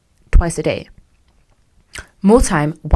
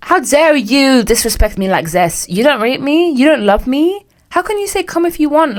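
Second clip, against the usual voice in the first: mock outrage; 7 semitones higher than usual. Acted outraged girlfriend.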